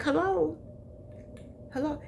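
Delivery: calling hello as if testifying; church hello. Retold or imitated. To the congregation, a high church-style hello. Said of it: "how you raise your hand in church".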